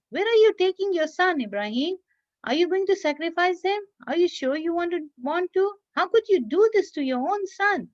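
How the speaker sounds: high, coaxing voice of a tempter posing as a man; sly trickster in disguise questioning a father; Shaitan disguised as a man. Acted. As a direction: pitch it high and wheedle, trying to talk him out of it. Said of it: "pretending to be a man. He asked".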